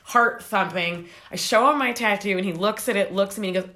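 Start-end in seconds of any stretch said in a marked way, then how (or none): none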